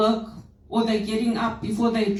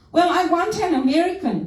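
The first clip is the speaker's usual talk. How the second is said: insistent, higher-pitched caller demand; 7 semitones higher than usual. Retold or imitated. Caller insisting on an American agent, demanding. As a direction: push it like an entitled customer. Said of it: "That's how they talk to you".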